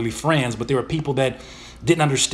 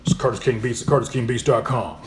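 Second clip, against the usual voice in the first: put-on lower stage voice; Curtis King voice; 3 semitones lower than usual. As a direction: drop back into the on-air persona. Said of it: "back to my Curtis King voice".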